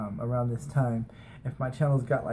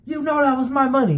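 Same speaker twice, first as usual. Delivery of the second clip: high, louder scolding aunt; angry aunt; 11 semitones higher, 5 dB louder than usual. Retold or imitated. Aunt scolding nephew in front of kids, mock angry. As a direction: hit her with the accusation, imitating the aunt. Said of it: "And she's like".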